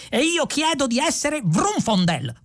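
high-pitched strained cry; excitable high-voiced protester; yelled declaration. A narrator's reading. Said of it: "urlò il più giovane".

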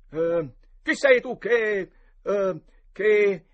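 loud, high, slow, stuck on the line; faltering challenge. A narrator's reading. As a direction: declaim boldly, then stumble for words. Boy forgets his memorised line. (battuta declamata e inceppata)

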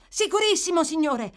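high, loud, excited confirmation; emphatic assurance. Acted. Boy assuring the gentleman, excited. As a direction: answer with glad certainty.

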